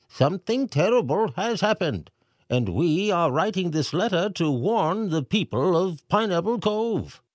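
high, pompous mayor voice reading aloud; mayor reading letter. A narrator's reading. Mayor reads the pelican's message to the crowd, pompous. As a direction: read the message like a fussy mayor.

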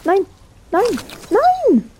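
rising horrified cries of no; shocked no. Real, not acted. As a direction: scream it in disbelief. Teammate eats pizzas, protest. (entsetzt schreien)

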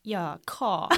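"car" with no R, a South African "kʰɑ"; South African accent. Retold or imitated. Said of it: "And I'd be like".

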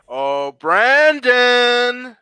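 slow, stretched-out Oh, Brandon; drawn-out congratulation. Real, not acted. Host rewarding Brandon for guessing the grade, drawn-out congratulation. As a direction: draw the name right out.

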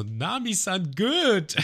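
hoch schwärmend gerufen; verliebter Sanji. Retold or imitated. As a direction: schmachte wie Sanji. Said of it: "Sanji so". (hoch schwärmender Sanji)

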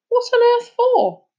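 very high, drawn-out question; slow, squeaked-up incredulous protest; exasperated challenge. Acted. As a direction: demand a reason in disbelief. Sulky boy challenges friend.

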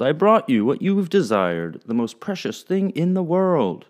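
a hint of a proud sea captain. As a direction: present the gift with pride. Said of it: "he called".

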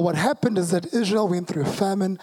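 normal talking voice

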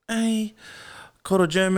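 mock him by repeating quarter German; mocking echo.

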